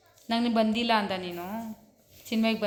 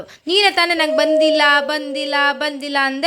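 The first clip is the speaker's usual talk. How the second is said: high and loud, mocking his own words back at him; loud teasing told-you-so; teasing reproach; 5 semitones higher, 6 dB louder than usual. Real, not acted. Mother teasing child's earlier words.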